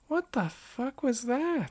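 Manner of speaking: high, slow appalled question; disgusted disbelief. Retold or imitated. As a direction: recoil at what you just saw. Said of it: "I was like".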